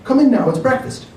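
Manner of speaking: high, loud call from the camper. A narrator's reading. Mother calling her daughter from the camper. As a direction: call across the campsite. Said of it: "called the woman".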